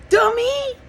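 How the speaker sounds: high mimicked name-calling shout; imitated loud yell. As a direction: yell the insult as he did. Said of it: "Again, really loud".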